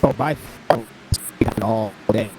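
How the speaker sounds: glitchy robotic connection; robot voice from a bad line. Real, not acted. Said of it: "Sounded like a robot".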